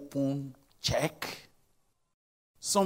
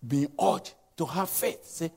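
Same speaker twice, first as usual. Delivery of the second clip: ordinary talk, nothing marked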